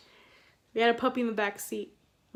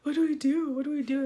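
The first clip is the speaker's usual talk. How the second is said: high, loud mock alarm; retold panic; 5 semitones higher, 5 dB louder than usual. Storyteller replays asking stuck friend for help, mock panic. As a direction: act out helpless panic, raised pitch.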